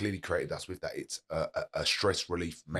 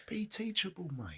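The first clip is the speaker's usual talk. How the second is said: laughing whisper; 13 semitones higher, 3 dB quieter, slower than usual. Real, not acted. Laughing advice.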